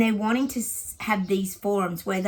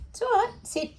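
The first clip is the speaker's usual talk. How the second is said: high soothing. Real, not acted. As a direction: coo it to calm the dog. Soothing the dog.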